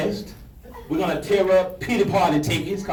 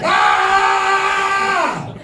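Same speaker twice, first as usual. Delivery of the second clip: high, loud full-throat "Ah!"; big release shout; demo yell; 7 dB louder than usual. Shown as a demonstration. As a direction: belt it from the diaphragm. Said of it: "I want you to say".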